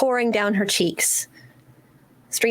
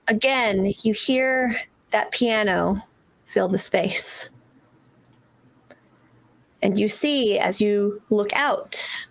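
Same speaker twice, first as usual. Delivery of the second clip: game master narration. A narrator's reading. GM scene setting narration.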